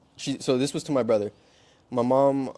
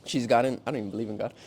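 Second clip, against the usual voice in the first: ordinary delivery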